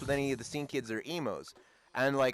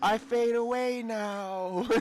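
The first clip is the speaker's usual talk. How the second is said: high, drawn-out comic voice; mock fading away; 9 semitones higher, much slower than usual. Joking that the Sri Lankan guy vanished, high mock farewell. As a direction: stretch it slowly in a put-on high voice. Jokey voice for departing man.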